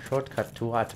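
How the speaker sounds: ordinary talk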